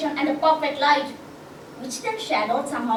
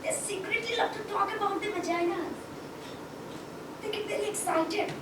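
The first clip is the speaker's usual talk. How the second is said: hushed, higher-pitched confidence; soft, gossipy aside; confiding secretly; 7 semitones higher, 8 dB quieter than usual. Acted. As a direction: let the audience in on a secret.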